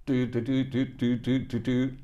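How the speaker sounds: high, clipped beeping of Morse code; Morse code beeps. Shown as a demonstration. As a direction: tap out CQ in Morse with your voice. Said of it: "learned how to do the Morse code".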